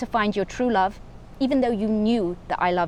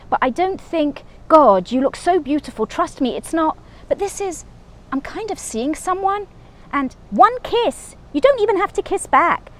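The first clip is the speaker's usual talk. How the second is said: pleading ex; 6 semitones higher, 3 dB louder than usual. Acted. David begging for a goodbye kiss.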